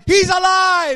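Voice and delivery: high, loud urgent shout; imitating a frantic nurse like a movie; excited shout. Nurse announcing the father lives, high excited shout. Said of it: "That's the words".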